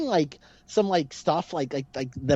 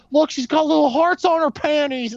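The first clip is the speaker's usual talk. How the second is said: excited high-pitched Stifler quote, a bit louder; imitating Stifler; 10 semitones higher, 4 dB louder than usual. Podcaster quoting Stifler's leering line, excited and high. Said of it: "the way he just, like, enunciates that".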